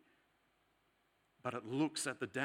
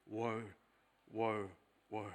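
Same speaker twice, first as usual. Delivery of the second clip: soft, lower woe, woe, woe; hushed woe; 4 semitones lower, 6 dB quieter, slower than usual. Acted. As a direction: murmur the woes gravely under the breath. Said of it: "the voice that cries at the end of days".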